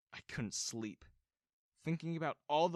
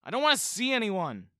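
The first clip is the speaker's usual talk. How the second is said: loud, higher shout; yelled; 9 semitones higher, 12 dB louder than usual. A narrator's reading. Son shouting through his bedroom door, loud yell. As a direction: shout them all away. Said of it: "I yelled".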